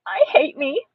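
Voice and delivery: mildly upset, high and plaintive; understated childish misery; tearful self-pity. A narrator's reading. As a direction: say it on the edge of tears. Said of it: "my eyes got tears in them".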